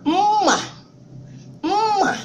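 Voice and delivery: "Mma" said high, the long nasal m held before the vowel; Igbo word demo. Shown as a demonstration. Teaching a class, pronouncing "Mma" carefully and high. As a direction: sound out "Mma" slowly for learners.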